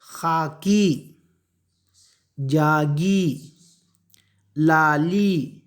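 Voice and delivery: very slow, each syllable held long; drawn-out classroom word reading; read-along recitation. Shown as a demonstration. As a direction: stretch both syllables so pupils can follow. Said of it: "अब मेरे साथ पढ़ो ये".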